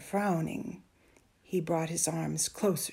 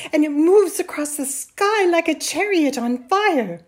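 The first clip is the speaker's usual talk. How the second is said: put-on high, loud, excited boy; 9 semitones higher, 13 dB louder than usual. A narrator's reading. Boy tells mother about the sky, excited. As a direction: burst out with amazing news. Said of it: "talking excitedly".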